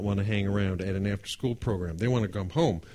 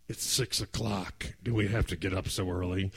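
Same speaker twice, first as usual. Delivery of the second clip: sleepy parents; 4 semitones lower, 6 dB quieter than usual. Retold or imitated. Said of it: "they're like".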